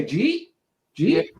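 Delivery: Jeet asked with a rise, did you eat squashed; quick questioning Jeet; Philly local asking Jeet?; Philly slang. Shown as a demonstration. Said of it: "We just say".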